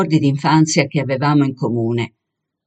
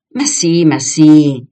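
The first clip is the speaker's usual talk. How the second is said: louder, emphatic insistence; exclaiming; 6 dB louder, slower than usual. A narrator's reading. Girl answering her friend's question, exclaiming. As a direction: exclaim it impatiently, swelling on the repeat. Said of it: "esclamò".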